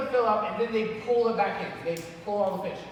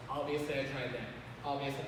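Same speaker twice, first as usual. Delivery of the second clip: low, muted annoyed retort; mock insulted; 10 semitones lower, 11 dB quieter than usual. Insulted expert told how to do it, mock insulted. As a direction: grumble it under your breath. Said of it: "you feel kind of insulted".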